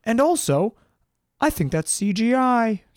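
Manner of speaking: high, smug know-it-all voice; mock flat earther. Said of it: "And the flat earthers are like".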